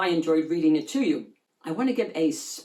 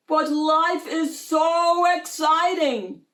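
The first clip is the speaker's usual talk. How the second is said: excited shark; 8 semitones higher, 5 dB louder, slower than usual. Acted.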